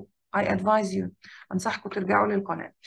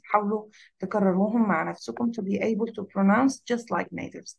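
usual speaking voice

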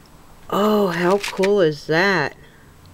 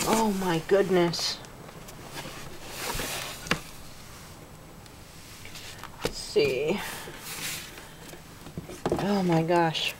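hushed, overwhelmed voice; holding back tears; 11 dB quieter than usual. Real, not acted. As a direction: speak softly to keep from crying. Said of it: "I'm not wanting to cry".

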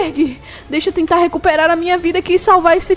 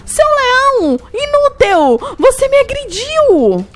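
hurt, angry shout; 8 semitones higher, slower than usual. Acted. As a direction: yell out in pain and anger. Struck by lion.

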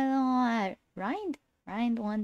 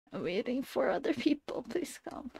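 whispered plea; 3 semitones higher, 6 dB quieter than usual. Real, not acted. Streamer whispers to absent viewers, begging them to come. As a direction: whisper it, pleading and soft.

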